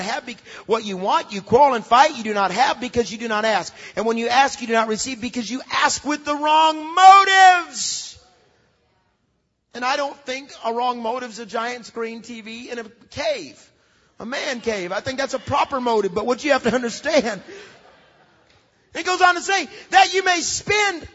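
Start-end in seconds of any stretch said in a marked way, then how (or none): 4.6-8.2 preaching at full force. Acted. 19.9-21.1 preaching emphasis. Acted. Preaching scripture, slowed and raised.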